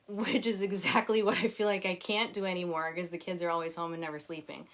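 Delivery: ordinary talk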